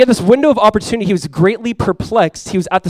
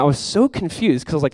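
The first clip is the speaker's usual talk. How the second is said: usual speaking voice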